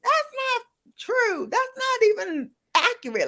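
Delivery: very high, indignant protest; mock yelling at the screen. Yelling at the TV show, in a very high indignant voice. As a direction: squeal your objection way up high. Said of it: "I'm like".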